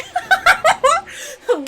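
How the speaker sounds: high cackle. Acted. Character's wild high laugh.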